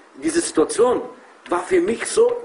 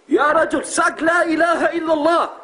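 pleading urge; 9 semitones higher than usual. Retold or imitated. Urging a dying man.

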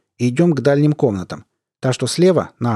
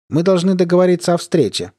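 raised phone voice; 7 semitones higher than usual. Acted. Caller on phone.

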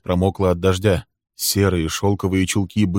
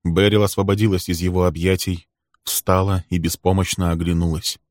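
ordinary delivery

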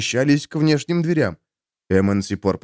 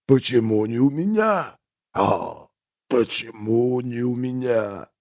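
creaking wail; 3 semitones higher, slower than usual. Acted. Corpse wails over and over about money. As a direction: wail it like a dead man. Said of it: "скрипящий вопль".

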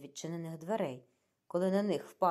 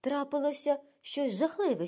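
much higher, alarmed outburst; frightened exclamation; 9 semitones higher, 4 dB louder than usual. A narrator's reading. Frightened Cornelia brings Jacqueline news of a death, exclaiming. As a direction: blurt out dreadful news in alarm. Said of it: "переляканими очима".

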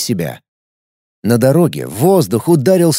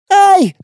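sudden high squeal of fright; girly shriek; 5 dB louder than usual. Acted. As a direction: squeal in panic at the slug. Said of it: "завизжала девчонка".

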